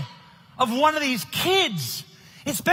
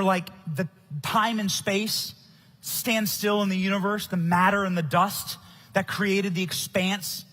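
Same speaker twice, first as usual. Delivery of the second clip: tender awe; 5 semitones lower, 5 dB quieter than usual. Real, not acted. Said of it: "I get emotional thinking about it".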